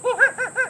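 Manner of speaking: short high monkey laugh; cheeky little monkey chatter; monkey giggle. Acted. George giggles at the warning, monkey laugh.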